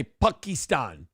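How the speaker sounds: "Pakistan" slow, as pock-ee-STAHN with a broad ah; Obama's Pakistan. Retold or imitated. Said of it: "when he used to say".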